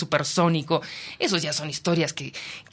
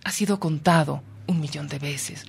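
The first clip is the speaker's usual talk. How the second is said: ordinary delivery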